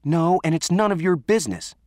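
high, indignant retort; angry comeback. Acted. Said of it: "Allie came back".

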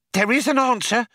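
high-pitched, excited question; breathless excitement. A narrator's reading. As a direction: blurt it high, hungry for confirmation. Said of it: "said Fook with breathless excitement".

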